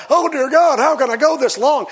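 high, loud mock lament to God; overwhelmed would-be pray-er; exasperated plea. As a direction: groan it in comic dismay at the task ahead. Acts out someone daunted by praying.

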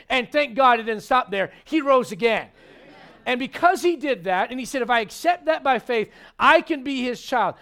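loud, rising preacher's call; preaching. Acted. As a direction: preach it out to the whole church. Preaching at full force.

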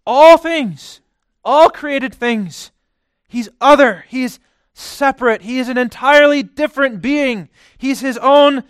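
high, loud, impassioned preaching; fervent preacher at full force. Acted. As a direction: drive it home from the pulpit.

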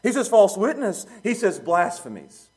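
loud, high preaching that hammers each point; preaching at full force. Acted. Preacher pressing the congregation, full-force preaching. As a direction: preach it hard, pounding the list.

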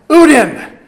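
uden punched out loud and high; emphatic uden. Shown as a demonstration. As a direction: hit the Greek word uden hard. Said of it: "So Paul is stressing so strongly".

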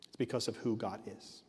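low, soft delivery; hushed statement. Real, not acted. Preacher giving reason number one to the church, hushed. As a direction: drop it low and quiet, letting it settle.

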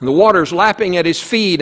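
ordinary talk

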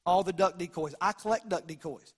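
ordinary talk

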